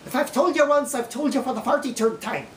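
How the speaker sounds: lilting Irish English accent. Shown as a demonstration. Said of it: "Irish people who speak English have a certain accent".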